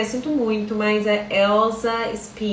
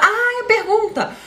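exclamação bem aguda, alongada; lembrança repentina; 12 semitones higher, 3 dB louder than usual. Real, not acted. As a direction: solte um "ah!" de quem quase esqueceu. (lembrança súbita, voz lá em cima)

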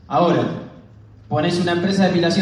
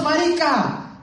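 shouted marica; 11 semitones higher, much slower than usual. Retold or imitated. Colombian speaker calling to the crowd, shouting marica high. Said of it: "él gritando".

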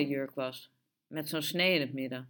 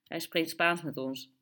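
ordinary delivery, nothing marked

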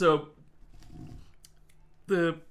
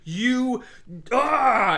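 loud, higher, furious noble yelling; furious accusation; 4 semitones higher, 7 dB louder than usual. Retold or imitated. Ramsay screams at Archibald over the armor, furious. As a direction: hurl the accusation at him. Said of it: "Ramsay's like".

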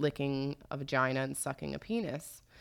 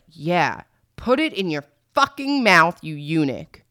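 loud, harsh dominant yell; rough commanding shout; 10 dB louder than usual. Retold or imitated. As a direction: bark it like a brute. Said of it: "the man's like".

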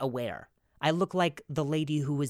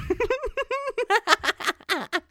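high laugh; 6 dB louder than usual. Real, not acted.

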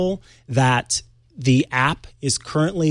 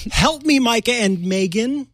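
higher-pitched mock cry for help; hammed-up plea; 10 semitones higher than usual. Host reads Bob's plea to the two hosts, playfully hammed-up. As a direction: plead it theatrically, as a joke.

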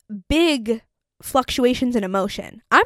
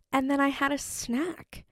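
normal talking voice

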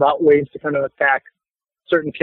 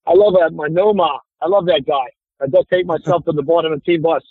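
thick working Boston accent. Shown as a demonstration. Actor recalling his Boston voice-over campaign, Boston accent. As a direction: talk like a hardcore Boston local. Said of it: "I've done hardcore Boston stuff there".

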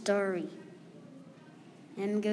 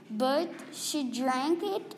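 usual speaking voice

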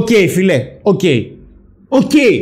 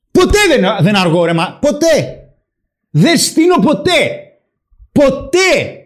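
ποτέ hit high and hard; Ποτέ stressed up high, almost shouted; emphatic never; 6 semitones higher, slower than usual. Real, not acted. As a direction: insist on ποτέ, every time. Insisting he is never late.

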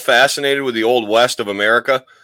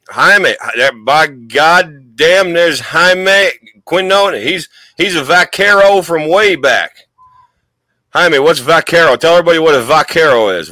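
put-on Southern accent; 4 semitones higher, 4 dB louder than usual. Host hails a guest from way back, hammed-up Southern accent. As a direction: hail an old friend with a stretched Southern twang. Hailing a guest in cowboy persona.

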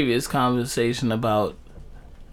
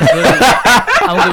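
laughing; 7 semitones higher, 7 dB louder than usual. Real, not acted. Laughing at his own joke.

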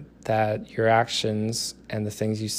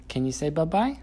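higher sing-song coaxing; 6 semitones higher than usual. Real, not acted. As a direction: coax the little one sweetly. Asking toddler to repeat.

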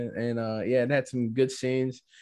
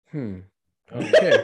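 skeptical hmm; 5 semitones lower, 5 dB louder, slower than usual. Retold or imitated. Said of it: "I was like".

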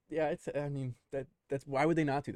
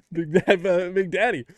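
laughing; 6 semitones higher, 10 dB louder, slower than usual. Pretend. Repeating flirty line.

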